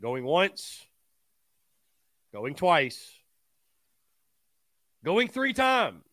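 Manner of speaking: mock auction count, building to a loud, drawn-out finish; playful going-once call, growing bigger; auctioneer-style countdown.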